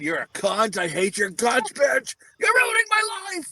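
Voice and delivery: retold drunken tirade building to a high-pitched yell; mock drunk outburst, rising sharply; drunken rant. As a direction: start near normal, climb into a hammed-up shriek. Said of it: "So I got drunk and said".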